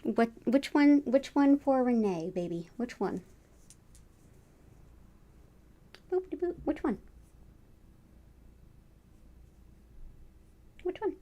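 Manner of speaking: high soft coaxing coo; pet talk. Real, not acted. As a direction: coax the kitty gently. Coaxing the cat to pick.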